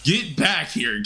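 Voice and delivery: drawn-out annoyed call at a fleeing monster. Real, not acted. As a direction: stretch it out, high and annoyed, at the fleeing spider. Chasing a fleeing spider boss.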